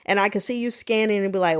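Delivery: ordinary talk, nothing marked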